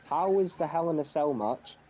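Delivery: ordinary delivery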